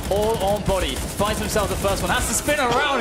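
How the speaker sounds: excited call. Real, not acted. Caster hyping the crowd on a wild spin, excited. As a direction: call it as it explodes.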